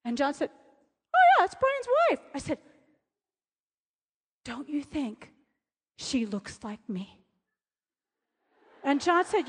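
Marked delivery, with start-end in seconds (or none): 1.2-2.2 high-pitched, put-on casual answer; imitating John's reply. John answering about Brian's wife, high mimicked voice. 4.4-7.2 soft, low and slowing; hushed question. Retold or imitated. Woman asking John about a resemblance, hushed.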